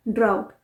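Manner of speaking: ड्राउट said with a silent GH; model pronunciation. Shown as a demonstration. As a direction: end ड्राउट on a clean T after an "ow". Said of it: "इसे हम ड्राउट pronounce करते हैं".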